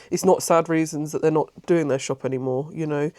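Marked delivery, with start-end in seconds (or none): none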